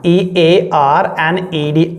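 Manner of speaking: teacher dictating letters for students to write; spelling it out slowly. Shown as a demonstration. Teacher spells the word for class, letter by letter, slowly. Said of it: "जहाँ पे क्या हो जाएगा?".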